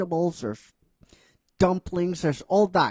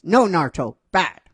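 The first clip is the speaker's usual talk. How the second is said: louder, mock-stern scolding; 3 semitones higher, 8 dB louder than usual. Ino scolding Naruto for tasting, loud and mock-stern. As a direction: tell him off like a naughty pet. Ino swats him with spoon.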